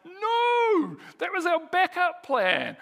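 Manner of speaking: high, loud, alarmed shout; acted cry of protest. Protesting losing the backup plan, high acted shout. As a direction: cry out in mock dismay, voice shooting up.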